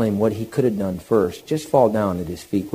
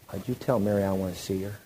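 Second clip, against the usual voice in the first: hushed request; 2 semitones lower, 9 dB quieter, faster than usual. Acted. Jesus quietly asking Martha for her sister, hushed request. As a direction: drop right down and ask it gently.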